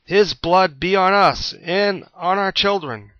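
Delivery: slow, loud, high yell; mob yell. A narrator's reading. Mob answers Pilate, slow loud yelling. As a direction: bellow it back as a mob. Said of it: "the mob yelled back".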